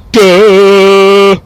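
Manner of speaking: loud, high, sustained "duh" like a horn; mocking honk imitation. Man mocking honking drivers, loud honk imitation. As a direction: hold a dopey honk on one note. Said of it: "Every time someone honks".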